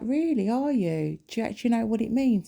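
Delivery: high-pitched, exaggerated feigned interest; mock-impressed scepticism. Challenging someone who claims to be spiritual, mock-impressed and sceptical. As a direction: draw out the disbelief up high, then quicken into the challenge.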